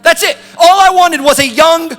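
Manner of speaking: put-on high, loud childish outburst; twelve-year-old tantrum. Grown son wants a goat from dad, childish tantrum. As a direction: yell like a kid denied a treat, rushing at the end. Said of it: "What is he, twelve years old?".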